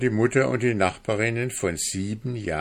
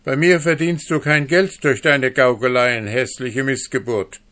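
higher, louder contemptuous scolding; angry scolding; 5 semitones higher, 6 dB louder than usual. A narrator's reading. Frau Hanne insulting the dwarf, loud contemptuous anger. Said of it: "entgegnete Frau Hanne zürnend".

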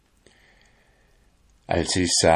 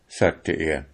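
plain reading voice

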